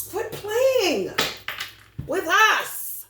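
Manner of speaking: high, loud warning yell; mock shout. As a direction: yell it to shut them down. Acted shout at outsiders.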